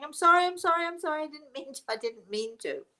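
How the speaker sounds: mock-tearful rush of sorries, pitched high then sinking; imitated sobbing apology. Frightened woman apologising to the person she nearly knocked down, imitated sobbing. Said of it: "she's sobbing now at this point".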